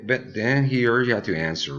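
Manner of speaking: usual speaking voice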